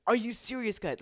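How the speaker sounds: high, loud exasperated yell; retold yelling. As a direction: yell at them in disbelief. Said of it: "I started yelling at them".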